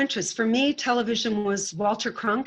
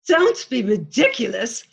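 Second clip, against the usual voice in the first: louder, higher mock Czech accent, slowing at the end; thick Czech accent; 4 semitones higher, 6 dB louder than usual. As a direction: Czech teen correcting a reporter, in a mock Czech accent. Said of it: "in her very thick Czechoslovakian accent".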